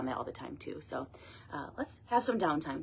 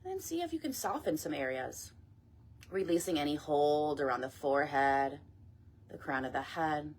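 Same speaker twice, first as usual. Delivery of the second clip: guided meditation; 5 dB quieter than usual. Acted. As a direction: ease them gently down, phrase by phrase. Leads a body scan meditation.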